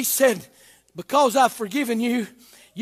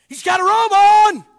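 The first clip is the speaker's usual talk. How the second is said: shouted higher, louder repeat; 8 semitones higher, 7 dB louder than usual. Acted. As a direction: ring it out to the rafters. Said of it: "repeated louder".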